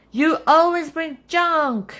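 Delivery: high, loud screech at someone; nasty scream. A narrator's reading. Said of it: "screamed Aunt Nasty".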